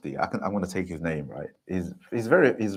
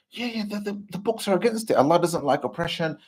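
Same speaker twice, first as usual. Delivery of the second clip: higher, eager and keen; eager student; 9 semitones higher than usual. Retold or imitated. Student insisting the books back him, eager. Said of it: "I'm like".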